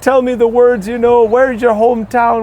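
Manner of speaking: high-pitched mock-Irish eagerness; fawning Irish crowd. Irish locals rallying round the American visitor, gushing high mock-Irish voice. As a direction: gush in a comically high, loud villager voice. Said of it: "they start going up and they rally around".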